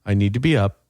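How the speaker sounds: normal talking voice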